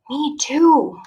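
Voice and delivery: ordinary delivery, nothing marked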